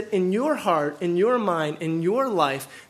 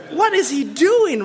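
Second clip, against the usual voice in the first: mock outrage; 6 semitones higher, 4 dB louder than usual. Repeats mocking Pharisee outrage.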